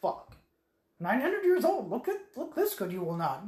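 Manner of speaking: lightly voiced Yoda, pitch jumping up; a quick, hammed-up Yoda quip; a hint of Yoda, half laughing. Yoda's retold line.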